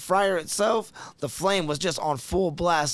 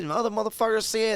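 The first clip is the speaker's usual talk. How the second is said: usual speaking voice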